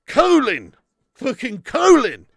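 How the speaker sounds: "Colin" as COH-lin, high and loud; American Colin. Retold or imitated. Streamer scoffing at the American way, "Colin" as COH-lin. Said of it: "They say".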